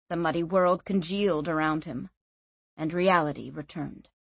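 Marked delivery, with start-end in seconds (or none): none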